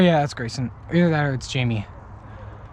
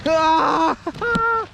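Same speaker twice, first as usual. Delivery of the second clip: overacted death cry; 8 semitones higher than usual. Shot, mock dying.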